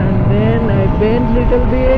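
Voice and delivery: shouting above the wind on a ride; loud over wind. Real, not acted. Riding fast, speaking over wind.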